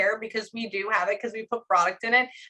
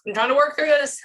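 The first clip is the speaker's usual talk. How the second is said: struggling through tears; 6 semitones higher, 7 dB louder than usual. Real, not acted. Fighting tears during the reading.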